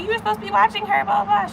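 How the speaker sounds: fast, high-pitched mock nagging; retold rant, high and hurried; imitated scolding. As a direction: rattle off a mom's telling-off way up high. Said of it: "the sister was getting yelled at".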